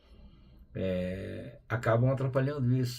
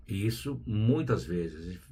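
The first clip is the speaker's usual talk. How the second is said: ordinary talk, nothing marked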